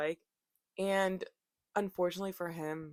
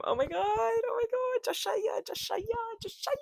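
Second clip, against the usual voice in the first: much higher, squealing with laughter; excited squeal. Real, not acted. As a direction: squeal his name as you crack up. Cracking up, calling to Joshua.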